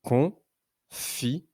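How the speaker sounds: "Confiture" in three widely spaced syllables, long F. Shown as a demonstration. Teacher helping pupil locate the F sound, "Confiture" syllable by syllable. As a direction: isolate con, fi, ture, holding the F of fi. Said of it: "le son f".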